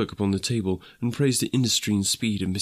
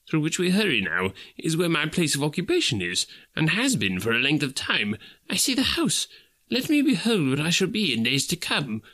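higher, agitated character voice; lightly voiced Scrooge, urgent; 6 semitones higher than usual. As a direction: plead with the spirit, pitch climbing.

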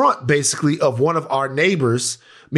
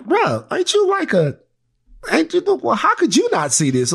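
incredulous; 13 semitones higher than usual. Retold or imitated. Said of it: "and I'm like".

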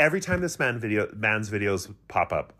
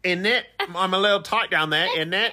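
mock British accent; 7 semitones higher than usual. Keeps mocking the British trainer.

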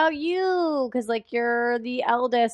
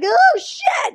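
high startled yelp, louder; shocked yelp; 6 dB louder than usual. Retold or imitated. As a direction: blurt it out in shock. Retelling own shock.

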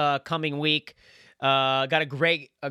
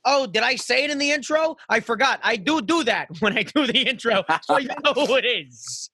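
high, loud, energetic host intro; hype podcast host; 9 semitones higher, 3 dB louder than usual. Acted. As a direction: pump up the crowd for the guest. Podcast intro over music.

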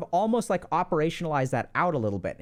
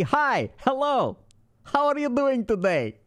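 over-eager greeting; 6 semitones higher, slower than usual. Retold or imitated. Mocking an overeager stranger at a party, gushing high. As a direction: overdo it like a too-eager host. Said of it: "Or you can be".

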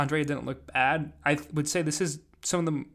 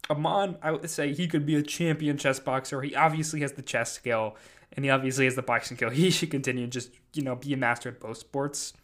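normal talking voice

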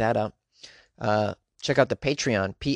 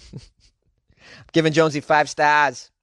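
slow put-on Boston accent after a laugh; 2 semitones higher, 5 dB louder, slower than usual. Podcaster imagining a five-star listener review, slow Boston accent. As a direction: stretch it out in mock Boston accent. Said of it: "read it in a Boston accent".

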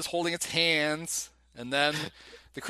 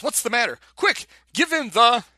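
higher, louder imitation of an urgent call; urgent quote; 6 semitones higher, 6 dB louder than usual. Host retells the doctor's call to his helpers, urgent and raised. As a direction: call it out urgently, acting the scene. Acting out frantic film doctor.

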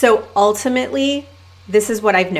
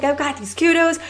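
mock excited caller; 7 semitones higher than usual. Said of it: "she was so excited".

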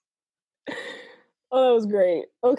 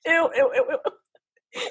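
laughing ew; 4 semitones higher, slower than usual. Real, not acted. Disgusted reaction to the letter.

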